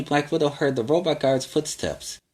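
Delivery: usual speaking voice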